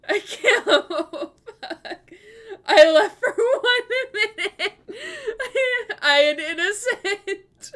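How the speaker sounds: high, loud, slow, laugh-choked protest; real laughing tears; crying with laughter. Real, not acted. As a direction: plead innocence while wheezing.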